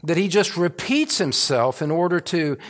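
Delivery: loud, climbing pulpit delivery, pressing each phrase; full-force preaching. Acted. Pastor preaching to his congregation, loud and fervent. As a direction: preach it out with rising fervour.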